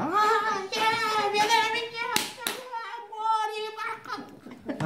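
very high, sing-song dwarf voice, cackling; tiny dwarf taunt. Acted. Caged dwarf jeering at passersby in a very high sing-song voice. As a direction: squeal it like a nasty little gnome.

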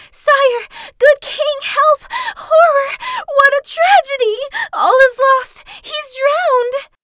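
high, frantic screaming. Acted. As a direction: scream for help in panic. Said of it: "ran to the palace screaming".

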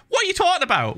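high-pitched exasperated question; baffled, worked-up challenge; incredulous protest. Real, not acted. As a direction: throw the question up high in disbelief. Incredulous at plot inconsistency.

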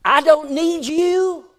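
slow, loud, high mock defiance; defiant retort. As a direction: throw it back at him. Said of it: "said these words".

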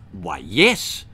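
low sly toad. Acted. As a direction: drop low and knowing. Tongue Toad voiced in story.

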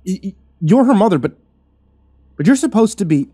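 loud, high, stammering; reeling at meeting her mother; stunned disbelief. A narrator's reading. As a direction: stumble over the shock. Shocked disbelief.

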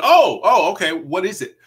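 high, loud excited questioning; eager surprise. Retold or imitated. Actor hearing from the agent, high and eager. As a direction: pipe up with sudden interest. Retold reaction to agent's call.

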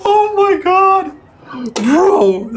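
loud, high-pitched amazed reaction; amazed outburst. Real, not acted. Player reacting to his modded game lines, amazed outburst.

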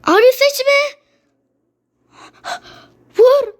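high desperate shout. Real, not acted. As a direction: yell it out high. Crying out at the screen.

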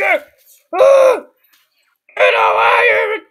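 loud, strained yell to drive someone off; panicked scream. Real, not acted. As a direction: scream it out, dragging the words. Screams at attacker in game.

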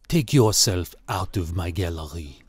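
low, subdued character voice for the owner; gallery owner. A narrator's reading. Gallery owner confronting an unwelcome visitor, low-voiced.

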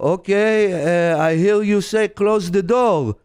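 put-on slow, suspicious Israeli-accented customer. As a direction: imitate halting Israeli-accented English, drawn out at the end. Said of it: "And he goes".

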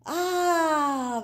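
high, loud admiring ah; admiring sigh. Acted. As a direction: sigh in delight. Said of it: "the crowd sighed".

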